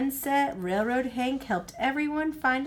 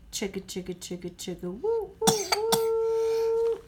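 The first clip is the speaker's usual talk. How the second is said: choo-choo train sound, chug then whistle; train chugging and whistle; 11 semitones higher than usual. A narrator's reading.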